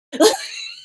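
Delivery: sudden squeaky laugh; quick high-pitched laugh. Real, not acted. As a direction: burst into a high giggle. Laughs at own joke.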